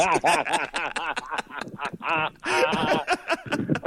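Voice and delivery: real high-pitched laughing fit; breathless, high real laughter; long laugh. Real, not acted. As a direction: laugh hard at the punchline. Punchline of Deke story.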